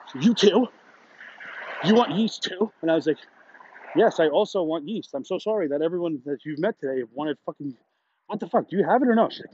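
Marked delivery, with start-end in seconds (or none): 0.1-2.7 mock exasperated lady. Imitating the lady's annoyed question. 3.9-9.3 exasperated retort. Retold or imitated. Retold answer to the lady.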